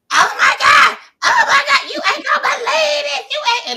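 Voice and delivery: very high, loud mock scream; retold fan scream. Guest to her husband on the phone, imitated screaming. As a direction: squeal it loudly, imitating a starstruck fan. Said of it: "just literally screaming out".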